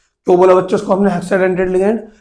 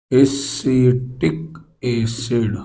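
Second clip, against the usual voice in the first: Acetic acid stretched syllable by syllable, low; slow dictation; 4 semitones lower, 5 dB quieter, much slower than usual. Real, not acted. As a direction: draw out acetic acid very slowly for note-takers. Repeats term slowly for writing down. (Acetic acid को धीमे, खींचकर लिखवाते हुए)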